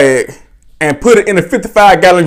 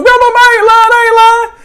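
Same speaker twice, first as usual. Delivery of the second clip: very high, hammed-up wail; mock pleading; faster than usual. Cousin on the floor denying it to grandmother, mock desperate. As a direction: imitate a kid begging off a whooping.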